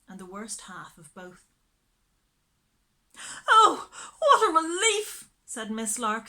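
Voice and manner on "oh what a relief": high, loud gushing relief; loud relieved sigh. Acted. Miss Lark watching her dog return, sighing loudly. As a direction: sigh it out big and shrill with relief. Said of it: "sighing loudly".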